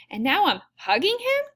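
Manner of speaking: high, disbelieving question; incredulous. A narrator's reading. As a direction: pitch leaps up in mild shock. Narrator startled by her own hug.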